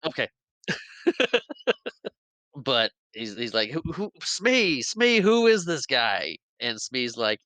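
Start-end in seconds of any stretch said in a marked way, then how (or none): 3.7-6.4 high, slow, drawn-out call for help; mock bewildered captain. Hook confronted by a stranger, mock bewildered call. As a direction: imitate a baffled captain calling his henchman. Confused Hook calls for Smee.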